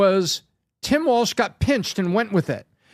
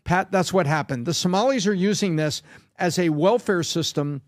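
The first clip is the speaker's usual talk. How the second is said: ordinary delivery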